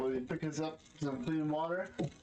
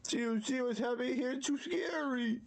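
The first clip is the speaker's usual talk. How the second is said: higher, fast scared voice; mock scared; 10 semitones higher than usual. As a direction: pretend to be spooked. Scared game commentary.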